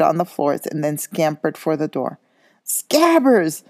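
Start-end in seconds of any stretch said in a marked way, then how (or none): 2.6-3.6 high loud shout of the name. A narrator's reading. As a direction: call after the fleeing rat. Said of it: "Ron shouted".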